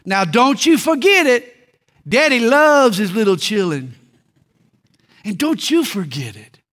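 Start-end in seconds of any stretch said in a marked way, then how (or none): 0.0-4.0 folksy Southern pastor's drawl reminding his people; a hint of a Southern country drawl. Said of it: "in his down-home Southern drawl".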